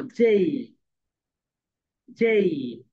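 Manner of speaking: teacher modelling যেই for the class to repeat; slow model word. Shown as a demonstration. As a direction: lead the class slowly on যেই. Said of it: "সবাই বলো".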